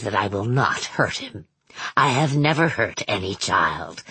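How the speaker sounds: deep, measured villain voice; Manx's low voice. A narrator's reading. Narrator voices Manx.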